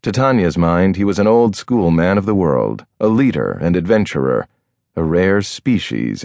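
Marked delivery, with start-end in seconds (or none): none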